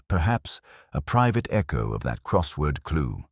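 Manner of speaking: ordinary delivery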